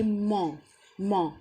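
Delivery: ما with silent t, nasal ending only; ment said as ma. Shown as a demonstration. Teacher modelling ment as ما. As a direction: say ما, dropping the final t. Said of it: "المقطع دوت بيتنطق ما".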